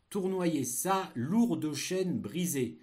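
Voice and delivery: slow, high dictation voice; dictating. Shown as a demonstration. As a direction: dictate slowly and clearly. Dictation of text chunk.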